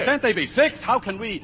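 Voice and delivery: desperate plea. Acted. Pleading with Joe over cylinders.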